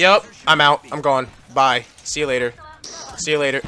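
loud, abrupt sign-off; loud hasty goodbyes; calling out loudly. Real, not acted. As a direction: shout your exit in short bursts. Bailing out of a firefight.

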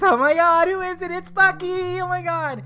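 high, loud, gushing fan voice; mocking fans gushing that Bucky is Cap's boyfriend, squealing high; mock fangirl squeal.

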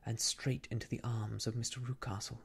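ordinary delivery

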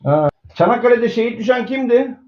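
ordinary talk, nothing marked

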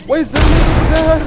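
baffled shout. Real, not acted. Baffled mid-fight.

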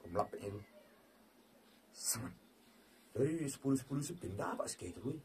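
normal talking voice

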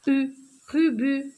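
"Urubu" split as u-ru-bu; "Urubu" in detached, measured syllables. Shown as a demonstration. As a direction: clap out the beats of "Urubu". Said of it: "je frappe les syllabes".

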